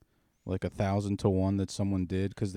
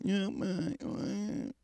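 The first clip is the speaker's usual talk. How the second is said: high, slurred words from half a head; mangled speech; 12 semitones higher, much slower than usual. Retold or imitated. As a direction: squeeze it out high and broken. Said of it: "You're going".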